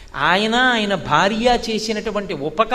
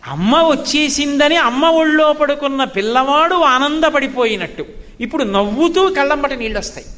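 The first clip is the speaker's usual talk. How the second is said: normal talking voice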